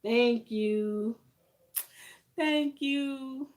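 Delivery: choked-up thanks, fighting tears; holding back tears. Real, not acted. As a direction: thank them while trying not to cry. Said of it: "I'm not gonna cry".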